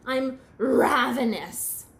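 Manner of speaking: loud, drawn-out growl of hunger; dramatic famished Bird; desperately hungry. Acted. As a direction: stretch it out loudly, famished. Hungry Bird complains to Raccoon.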